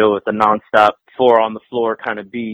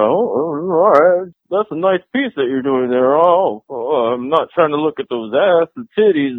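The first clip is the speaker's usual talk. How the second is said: awkward gawker; 3 semitones higher than usual. Retold or imitated. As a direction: act like a dude trying not to stare. Mimics gawking dudes at show.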